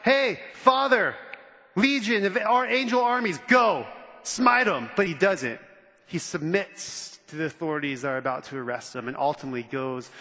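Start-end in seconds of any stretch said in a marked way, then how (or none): 0.0-4.9 pretend battle cry. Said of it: "He could have gone".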